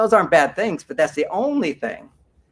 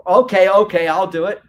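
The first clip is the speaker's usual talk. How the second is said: loud, exasperated okay okay; grumbling agreement; 2 semitones higher, 8 dB louder than usual. Retold or imitated. Husband gives in to wife's request, loudly grumbling.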